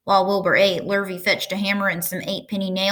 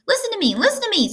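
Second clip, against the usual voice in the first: much higher goose scream; 13 semitones higher, faster than usual. A narrator's reading. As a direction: demand attention like the goose. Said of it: "screamed the goose".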